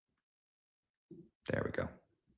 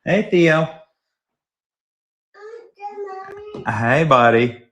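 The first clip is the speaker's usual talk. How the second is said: louder, higher call across the room; 9 semitones higher, 6 dB louder, slower than usual. Real, not acted. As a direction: call him over warmly. Calling his son over.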